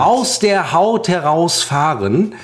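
slow, drawn-out exasperation; slow, heavily spaced emphasis; exasperated. Real, not acted. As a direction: stretch every word with pent-up annoyance. Exaggerated threat to lose temper.

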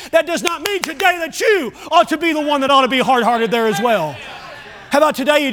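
high, ringing preacher shout; preaching. Real, not acted. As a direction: preach it out with full force. Preaching at full force.

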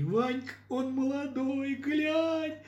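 high excited calling out; delighted shout. Acted. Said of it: "Голос с галереи в восхищении".

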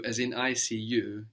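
ordinary delivery

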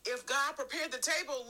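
climbing into full preaching voice; rising sermon fervor. Acted. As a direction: lift the congregation toward the table.

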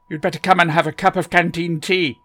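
eccentric Doctor voice. A narrator's reading. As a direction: bustle in as a fussy, kindly eccentric.

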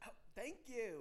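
light Aussie voice; Australian accent. Pretend. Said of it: "Why are you Australian?".

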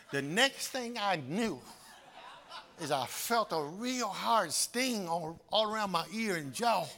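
ordinary talk, nothing marked

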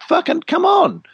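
high-pitched exasperated come on. Real, not acted. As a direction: throw your hands up, pitched way high. Exasperated plea at nature's manual.

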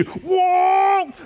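high shouted whoa; startled whoa. Retold or imitated. Wife startled as the car takes off, high shouted whoa. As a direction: yelp it as the car lurches.

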